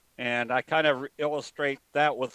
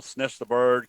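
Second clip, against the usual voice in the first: ordinary talk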